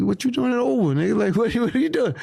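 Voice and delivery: high, laughing disbelief at his brother; retelling the shock with a laugh; incredulous. Retold or imitated. Friend shocked brother took Uber.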